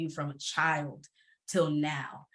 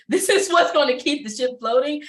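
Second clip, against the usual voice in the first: laughing; 7 semitones higher, 8 dB louder than usual. Real, not acted. Laughing at her own brain joke.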